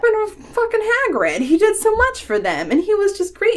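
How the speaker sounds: real emotion breaking through, high and tight; choked up. Real, not acted. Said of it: "I'm gonna get emotional".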